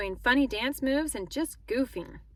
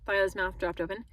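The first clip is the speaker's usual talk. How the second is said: ordinary delivery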